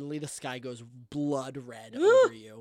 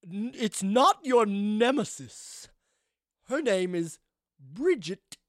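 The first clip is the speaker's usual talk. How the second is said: slow, higher-pitched grande dame; theatrical ghost madam; 6 semitones higher, 6 dB louder, slower than usual. Acted. Ghost corrects a visitor about her nemesis, slow and theatrical. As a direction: spell it out slowly and grandly.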